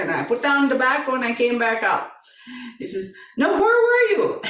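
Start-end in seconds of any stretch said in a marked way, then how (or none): 3.4-4.4 imitating an upset son, high-pitched; high, urgent repeated question; insistent demand.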